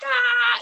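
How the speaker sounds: high, loud drawn-out "ah"; imitated battle cry. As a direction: cry out high like charging hobbits. Imitating Merry and Pippin's cry.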